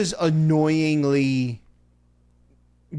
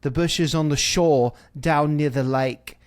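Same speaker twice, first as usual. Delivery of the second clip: slow, put-on British accent; fake British accent; slower than usual. Contestant showing berries she found, in a fake British accent. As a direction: imitate an affected British speaker, drawn out. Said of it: "had a fake British accent".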